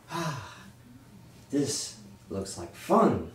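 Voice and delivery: sly goblin. Acted. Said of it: "He says".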